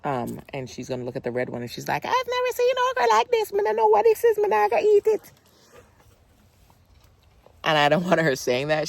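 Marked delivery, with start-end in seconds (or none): 2.0-5.3 high-pitched imitation of mom's Jamaican accent; mock Jamaican mom refusing okra. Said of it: "that's her in her Jamaican accent".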